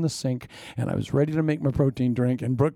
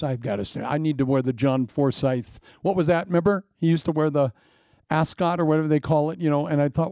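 ordinary talk, nothing marked

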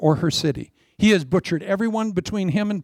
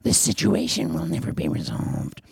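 much lower, mock devil-puppet voice; lower, hammed-up puppet voice; sock puppet voice; 13 semitones lower than usual. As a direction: drop low like a puppet the devil is working. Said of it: "you just find yourself saying".

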